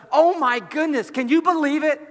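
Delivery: high, loud astonishment; amazed disbelief. Acted. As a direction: exclaim it as if overwhelmed with wonder. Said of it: "there isn't this sense of".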